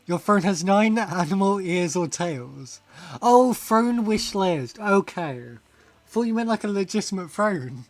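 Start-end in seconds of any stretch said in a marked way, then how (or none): none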